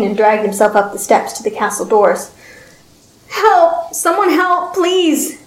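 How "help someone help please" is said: high, desperate pleading shout; frantic calls for help; begging cry for help. Acted. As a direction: beg for help in panic. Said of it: "he begged".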